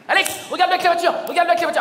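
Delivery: loud, fast excited calling. Acted. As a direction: call him over, thrilled. Parent excitedly calling friend.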